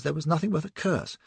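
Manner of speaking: usual speaking voice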